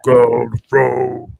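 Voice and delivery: frog voice. Shown as a demonstration. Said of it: "How do you do a frog voice?".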